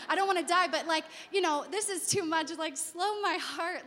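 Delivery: praying alone in the bathroom; panicked plea. Retold or imitated. Pleading in panic, retold.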